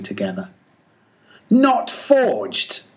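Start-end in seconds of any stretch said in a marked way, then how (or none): 1.5-2.8 very high, sharp scoffing cry; scornful exclamation. Acted. Ahab scoffs at the captain's doubt, high scornful cry. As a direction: throw the words back in scorn.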